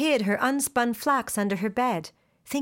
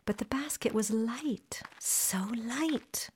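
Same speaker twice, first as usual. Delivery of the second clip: hushed whisper of suspense; whispered wonder; 8 dB quieter, slower than usual. A narrator's reading. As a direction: whisper it as if sharing a secret. Narrator hushes at mystery.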